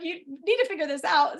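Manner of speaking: irritated patient laying down the law, acted out; retold irritation. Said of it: "like, all irritated".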